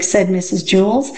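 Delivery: ordinary delivery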